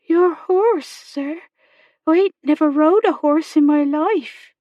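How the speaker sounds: put-on high, rustic girlish voice; amazed young girl, pitched right up; astonished servant girl. A narrator's reading. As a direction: blurt it out high, in country-girl dialect. Servant girl astonished at horse offer.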